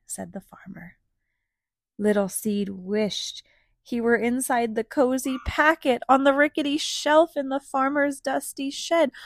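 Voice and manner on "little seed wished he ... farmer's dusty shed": reading a picture book aloud; lively read-aloud narration; story narration. A narrator's reading.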